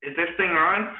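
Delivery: loud, high puppet-frog greeting; Kermit the Frog. Shown as a demonstration. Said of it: "I could try doing Kermit the Frog".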